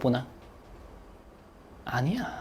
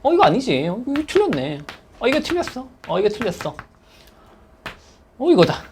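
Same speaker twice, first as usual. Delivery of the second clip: confident top student; 3 semitones higher than usual. Retold or imitated. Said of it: "풀 때 이렇게 풀어".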